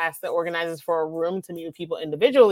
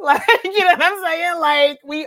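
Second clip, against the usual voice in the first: laughing through the words, pitched up; 9 semitones higher, 5 dB louder than usual. Real, not acted. To her interviewer, laughing about how great they are. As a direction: giggle it out, bubbly and loud.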